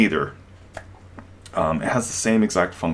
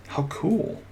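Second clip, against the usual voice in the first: sarcastic; 8 semitones higher, 6 dB quieter than usual. Pretend. Sarcastic mock praise.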